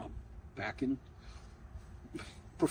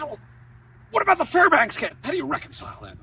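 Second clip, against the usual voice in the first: booming, higher professor voice grilling a student; stern professor; 10 semitones higher, 5 dB louder, faster than usual. Retold or imitated. Law professor cross-examining a nervous student, booming and stern. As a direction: play the dreaded law professor pressing hard.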